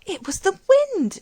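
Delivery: high, loud and delighted. A narrator's reading. Kneeling old woman, delighted by the lupins. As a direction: marvel at it aloud. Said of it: "she said as she knelt in delight".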